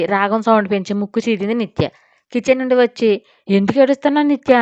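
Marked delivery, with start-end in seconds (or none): 3.5-4.5 higher, louder anxious question; worried. A narrator's reading. Aunt running from the kitchen to the crying girl, worried. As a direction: rush in alarmed at her crying. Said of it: "అని అడిగింది కంగారుగా సురేఖ".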